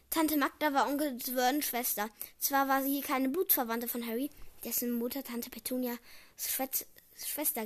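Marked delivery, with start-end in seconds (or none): none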